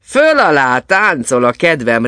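loud, merry exclamation; whooping. A narrator's reading. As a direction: crow it out in high spirits.

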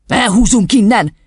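curt, irritable dad; barked reply. A narrator's reading. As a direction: bark it curtly at the child. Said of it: "vakkantotta Lonc úr".

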